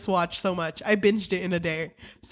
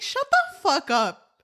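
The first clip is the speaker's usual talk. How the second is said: mock exasperated retort; 5 semitones higher than usual. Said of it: "And it was like".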